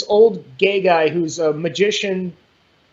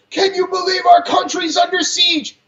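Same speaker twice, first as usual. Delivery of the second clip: higher, put-on voice of a fake-alarmed liberal; mock outraged liberal; 9 semitones higher, 3 dB louder than usual. Mocking liberals who claim the country is under siege, put-on alarm. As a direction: ham up the fake panic. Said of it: "You're play acting".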